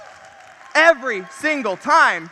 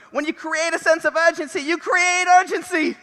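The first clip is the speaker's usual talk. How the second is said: bad Boston accent; a rough, hammy Boston accent; 4 semitones higher than usual. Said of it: "Terrible Boston accent".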